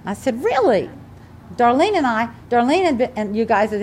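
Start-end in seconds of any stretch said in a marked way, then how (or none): 0.4-0.9 high, rising-then-falling surprised "really"; astonished, pitched well up; retold surprise. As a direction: react as if amazed by the news. Reacts to son's news, retold.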